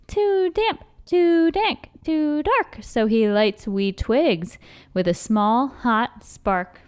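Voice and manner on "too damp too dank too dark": high, tiny mouse voice; squeaky mouse. A narrator's reading. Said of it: "Mouse squeaks".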